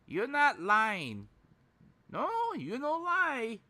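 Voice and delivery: high, drawn-out gullible pleading; imitating a trusting streamer in a high voice. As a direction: swoop up high, wanting to be reassured. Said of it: "she's like".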